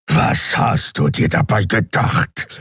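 slow, rough growl. A narrator's reading. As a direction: growl the question with menace. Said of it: "knurrte Keru".

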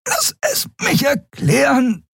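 high, slow, strangled plea; choking, breathless appeal; gasping. A narrator's reading. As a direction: gasp each word out, short of air. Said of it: "keuchte Endriel".

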